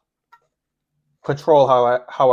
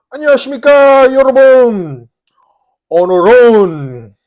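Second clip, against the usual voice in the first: mukbang host greeting; 9 semitones higher, 9 dB louder, much faster than usual. Pretend. Mock Korean TV host greeting.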